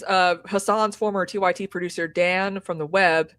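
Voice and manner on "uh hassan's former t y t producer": normal talking voice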